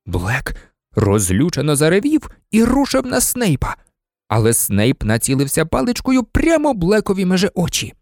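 usual speaking voice

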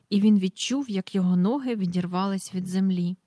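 plain reading voice

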